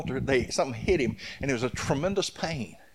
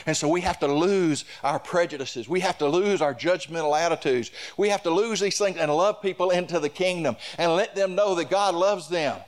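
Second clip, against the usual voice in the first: higher, louder preacher's cadence, slowing at the end; full-force preaching; 5 semitones higher, 3 dB louder than usual. Acted. Pastor exhorting the church to lose prejudice, full-force preaching. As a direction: call the people to love, building.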